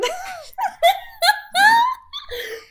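helpless high-pitched laughter; cracking up at the silly name; high squealing laugh. Real, not acted. Squealing laugh at the dog's silly name.

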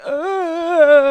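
high, loud mock cry; hammed-up "ah"; imitated yell. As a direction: wail like a pranked boyfriend. Imitates pranked boyfriend's cry.

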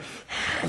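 imitated huffy child's sigh; audible sulky exhale, acted out; exasperated sigh. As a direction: heave a mock put-upon sigh. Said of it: "they smack the lips and they sigh".

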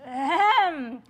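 loud, high "ahem"; showy throat-clearing. Pretend. Guest warming up for her hostess voice, showy ahem. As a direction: clear your throat grandly before performing. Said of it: "practice my best game show hostess voice".

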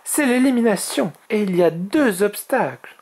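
high-pitched dragoness voice; high, animated storybook instructor; dragoness explaining rules. Acted.

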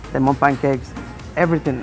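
normal talking voice

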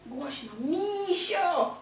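misio stretched slowly and clearly; drawn-out "Głośno, misio" as a model; slow drawn-out prompt. Shown as a demonstration. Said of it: "A powiedz tak głośno".

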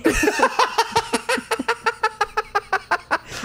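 a long burst of high laughter. Real, not acted. Telling about Jô Soares in the bathtub, a long high laugh. Laughing at her own story.

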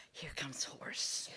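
slow, low, soft whisper; hushed whisper. Acted. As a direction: whisper a hopeful sighting to oneself. Man spots hope, whispered.

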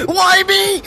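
high-pitched whiny squeal. Pretend. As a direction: squeak it like a cartoon.

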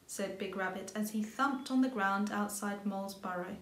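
usual speaking voice

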